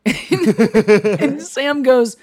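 long, high laugh that stretches the words out; can barely get it out for laughing. Real, not acted. Laughing fit before the punchline.

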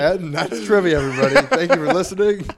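high, loud sign-off through laughter; real laughter breaking through the words; laughing. Real, not acted. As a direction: crack up while wrapping up the show. Cracking up at botched question, mock sign-off.

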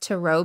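"tarot" as tuh-ROH; "tarot" rhyming with "arrow" avoided, said tuh-ROH; alternative tarot pronunciation. Shown as a demonstration. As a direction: say "tarot" with stress on the second syllable, tuh-ROH. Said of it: "I've heard it pronounced".

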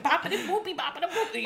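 high mock-Italian babble, half laughing; silly bouncing Italian gibberish; hammed-up cartoon Italian patter; noodly Italian. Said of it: "like, a noodly Italian".

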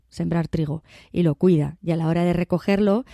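usual speaking voice

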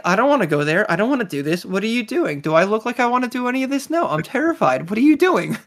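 reluctant droid's high-pitched protest; hammed-up griping with jumps in pitch; mock complaining. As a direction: whine in swooping, exasperated bursts. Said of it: "down there complaining".

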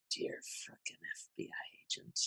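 breathy whisper of a private thought. Retold or imitated. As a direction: whisper it like a secret joke. Inner thought quoted during documentary.

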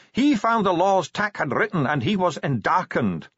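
high dwarf voice, settling at the end; Bashfulson reciting scripture. A narrator's reading. Dwarf translates the crackling voice, high and solemn.

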